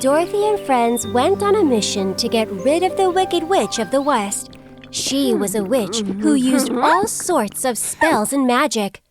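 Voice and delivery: usual speaking voice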